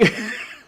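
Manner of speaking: real giggle, high pitched; short high laugh. Real, not acted. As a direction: burst out laughing. Laughing at own story.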